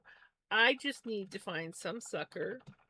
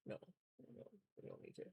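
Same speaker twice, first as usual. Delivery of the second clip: whispering; 21 dB quieter, much faster than usual. Real, not acted. Muttering to herself.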